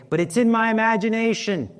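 high, sceptical protest; mock objection pitched high; doubtful objection. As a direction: play the listener's doubting comeback. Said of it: "And you'll say".

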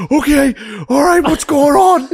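frantic, loud, very high imitation; hammed-up cracking panic. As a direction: play a flustered dad squeaking in panic. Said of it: "You can hear his voice crack".

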